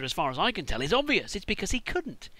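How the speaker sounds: ordinary delivery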